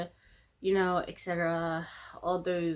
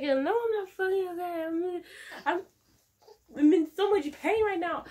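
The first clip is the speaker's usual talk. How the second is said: pained outburst; 10 semitones higher than usual. Retold or imitated. Retelling answer to husband in pain.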